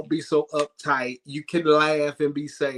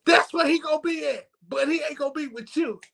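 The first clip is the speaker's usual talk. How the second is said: high mock grandmother; 12 semitones higher than usual. Grandmother voiced in story.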